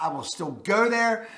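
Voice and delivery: ordinary talk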